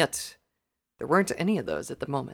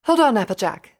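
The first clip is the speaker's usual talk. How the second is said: loud, higher-pitched call; raised voice to stop someone leaving; 8 semitones higher, 8 dB louder than usual. A narrator's reading. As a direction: call after a departing friend. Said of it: "Rarity called out".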